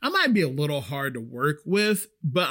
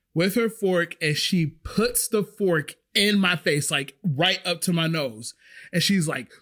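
ordinary talk

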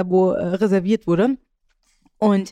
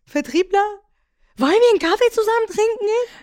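much higher, eager voice; high eager pickup line; 10 semitones higher than usual. Retold or imitated. Salesman asking her out for coffee, much higher and eager. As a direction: squeak the question up high. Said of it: "Aber genau in dieser Tonlage".

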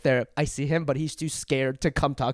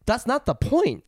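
higher-pitched exasperated retort; retold exasperation; 10 semitones higher, slower than usual. Woman retells arguing with store clerk over clothes, exasperated. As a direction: push back in exasperation, retelling it.

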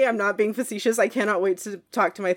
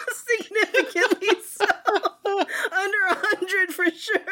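high voice breaking into real laughter; tearful laughing; 10 semitones higher than usual. Real, not acted. Telling co-hosts about resting heart rate, tearful laughing. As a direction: squeeze the words out through teary giggles. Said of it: "I might start crying".

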